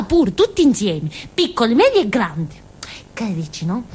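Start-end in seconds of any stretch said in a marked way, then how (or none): 3.1-3.8 whisper. Acted. Aside to listener.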